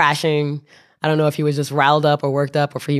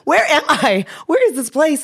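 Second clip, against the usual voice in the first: melodramatic; 13 semitones higher than usual. Retold or imitated. Narrator panicking at Bob, hammed-up high squeal. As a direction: squeal it like a lost tourist. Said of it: "my melodramatic self".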